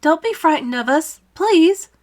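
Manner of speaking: put-on high, loud, boyish plea; calling out. A narrator's reading. James reassures the crowd below, calling out. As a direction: call down pleading, pitched well up. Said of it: "James called out".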